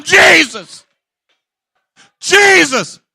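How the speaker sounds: loud pleading call. Acted. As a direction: call out to him, begging. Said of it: "She kept calling him".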